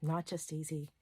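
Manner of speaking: normal talking voice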